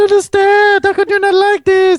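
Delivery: put-on high slurred French Canadian drunk voice; French Canadian drunk accent. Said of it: "I can hear him now in his French Canadian drunk accent".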